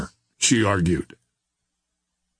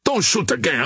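high, urgent call to hold fire; shouted; 7 semitones higher than usual. A narrator's reading. Officer identifying himself to an armed sailor, shouting. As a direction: call out who you are, pitched well up. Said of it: "he shouted".

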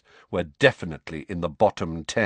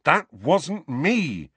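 higher, slow and emphatic protest; indignant denial; 8 semitones higher, 4 dB louder, much slower than usual. A narrator's reading. Boy protesting his innocence to his uncle, indignant denial. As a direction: deny it with rising irritation.

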